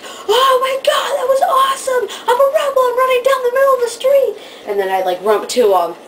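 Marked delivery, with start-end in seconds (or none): 0.0-4.4 high, loud, laughing thrill; running wild down the street after the show, screaming with delight; giddy shout. Real, not acted. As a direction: shriek with rebel joy.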